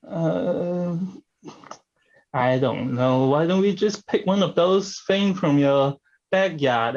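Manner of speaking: normal talking voice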